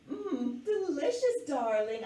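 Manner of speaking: high-pitched, playful grand delight; fancy-lady affectation. A narrator's reading. Girl plays fancy lady to sister.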